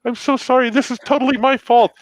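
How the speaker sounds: high, flustered nun apologizing. Retold or imitated. Nun apologizing to the driver she hit, high and flustered. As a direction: play the sweet nun saying sorry. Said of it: "she's like".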